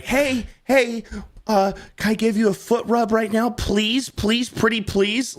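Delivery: very high, whiny-sweet pleading; mock begging. Man begging his woman to allow a foot rub, mock pleading. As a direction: beg in a put-on high voice, stretching the final pleases.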